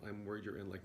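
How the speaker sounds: ordinary delivery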